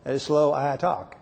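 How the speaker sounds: lightly voiced slow Southern drawl, stretched out; a hint of an Alabama drawl, drawn-out; mock slow Southern speaker. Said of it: "fellow from Alabama said".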